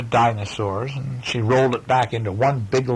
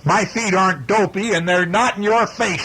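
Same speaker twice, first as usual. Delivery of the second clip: higher, indignant retort; shouting sister; 8 semitones higher than usual. Acted. Sister shouting back at her brother. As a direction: fire it back at him, offended. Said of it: "she shouted".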